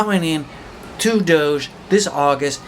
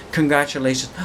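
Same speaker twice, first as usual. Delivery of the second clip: normal talking voice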